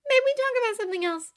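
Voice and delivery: high, quick change of subject; high fast plea. A narrator's reading. Glinda asking Oscar to change the topic, quick and high. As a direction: hurry past it, high and bright.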